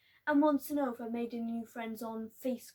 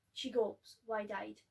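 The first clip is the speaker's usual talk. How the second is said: ordinary delivery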